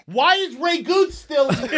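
slow, high-pitched yell; exasperated shout. Acted. Casting director fed up with the auditioner, exasperated shout.

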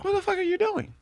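1 mock startled yell. Catches wife listening at door.

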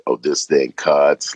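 low, slow drawn-out deep voice; put-on deep video voice. Shown as a demonstration. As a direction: drop it deep and stretch it out. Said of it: "like, the deeper".

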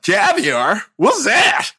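high, puzzled Southern drawl; mock Southern guest. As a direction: imitate the clueless guest, rising. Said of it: "is that your accent or is that their accent".

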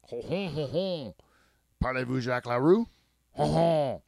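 hammed-up Parisian French accent, chuckling ho ho; mock French accent. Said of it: "That's my French".

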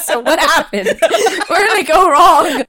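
hammed-up despairing father; father asking where his son went wrong, laughing; laughing dad imitation. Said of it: "He's like".

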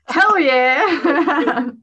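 loud, high, laughing cheer; excited. Retold or imitated. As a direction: cheer it like waking to great news. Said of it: "And I was like".

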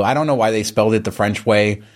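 normal talking voice

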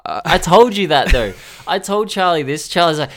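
ordinary delivery

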